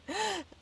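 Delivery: high sudden hiccup gasp; a gasp that catches mid-sentence; sharp gasp. Acted. Mister Penny's last try, gasping.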